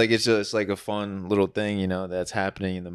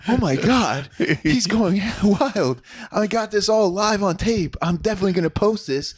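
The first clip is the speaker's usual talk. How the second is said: excited bystander; 11 semitones higher, 4 dB louder than usual. Retold or imitated. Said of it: "He's like".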